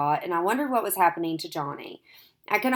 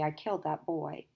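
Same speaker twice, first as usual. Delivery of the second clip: much softer, slow stunned murmur; 8 dB quieter than usual. A narrator's reading. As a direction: let it fall out, dazed and quiet. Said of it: "he said slowly".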